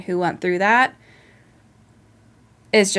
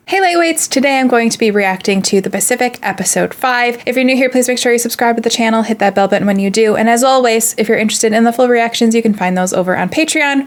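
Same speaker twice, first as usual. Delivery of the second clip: bright, louder youtuber greeting; upbeat channel intro; 3 semitones higher, 5 dB louder than usual. Acted. Host greeting Lightweights before the reaction, upbeat and louder. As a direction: pump up the viewers at the start.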